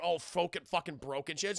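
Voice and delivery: ordinary talk, nothing marked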